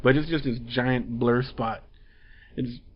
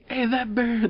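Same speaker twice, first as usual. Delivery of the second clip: much higher, alarmed call; startled shout; 11 semitones higher than usual. Retold or imitated. A city bystander spots the bear, mock alarmed and high. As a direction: point and cry out at the bear. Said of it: "there's like".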